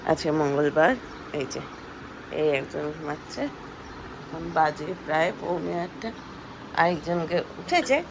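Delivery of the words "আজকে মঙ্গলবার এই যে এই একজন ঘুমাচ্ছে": normal talking voice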